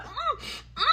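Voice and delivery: high, muffled hums through a covered mouth; muffled moans. Retold or imitated. Lisa moaning with John's mouth over hers. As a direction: moan with the lips pressed shut.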